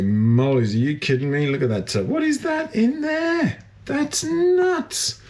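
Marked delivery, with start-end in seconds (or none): 2.1-5.2 high astonished shout; amazed by what he sees; shocked. Real, not acted. Shocked by the tub.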